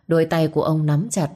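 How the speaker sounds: ordinary delivery, nothing marked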